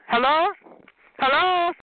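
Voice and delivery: high, questioning call. Real, not acted. Calling to a rival who won't talk, high call. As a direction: call out for an answer.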